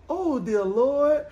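slow, drawn-out mock lament; pitying dismay. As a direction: imitate an older woman dismayed for you. Acting an old caller's pitying reaction.

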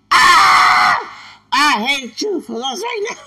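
very high, very loud shriek; hammed-up screaming; shrieked quote. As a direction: scream it in mock anguish. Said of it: "And I'm like".

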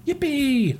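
high hammed-up yippee; cheer. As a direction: squeal it like a cartoon cheer. Comic character cheering.

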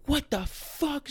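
a man learning he was fired; stunned disbelief. Retold or imitated. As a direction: gasp it out in utter shock. Reading the voicemail transcript.

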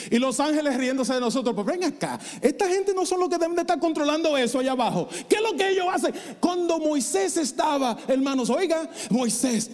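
high, ringing preaching cadence; full-force preaching. Acted. Preacher exhorting the church about authority, ringing and high. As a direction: lift the congregation with fire.

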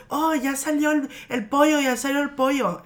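imitating an excited announcement; mock announcement. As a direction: call out the false good news like a cousin pulling a prank. Cousin's prank announcement acted out.

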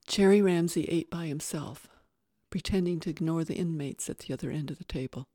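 ordinary delivery

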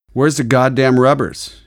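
calling out. A narrator's reading. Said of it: "she called to the annoyed cashier".

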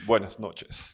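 slow throat-clearing stage opening; lightly voiced drag host. Shown as a demonstration. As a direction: greet the crowd like a drag show host. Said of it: "No, no, más, más agudo". (saludo lento de presentadora)

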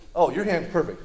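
usual speaking voice

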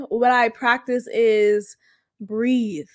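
ordinary talk, nothing marked

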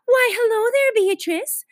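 high-pitched, effusive neighbour hello; gushing greeting. A narrator's reading. Neighbour greets Beezus on the street, gushing.

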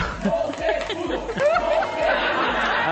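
a high burst of laughter. Real, not acted. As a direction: laugh it off, amused. Laughs about the heat.

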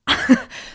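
sharp, stunned gasp; shocked gasp. Retold or imitated. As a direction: suck in air as if your jaw just dropped. Acts out jaw-dropping shock.

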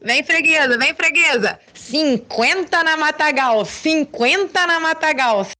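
high, slow sing-song vendor cry; market barker. Retold or imitated. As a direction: chant it like a street vendor hawking greens. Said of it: "olha o som que ele fazia".

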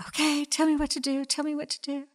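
high, fast anxious begging; clinging to the limb he begs the voice for orders; eager pleading. Retold or imitated. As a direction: ask urgently what to do. Joke: man pleads with voice.

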